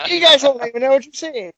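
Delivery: high squeaky puppet voice; dummy voice taunting the listeners; squeaky ventriloquist dummy. Pretend. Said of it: "Flawless ventriloquism is what's happening".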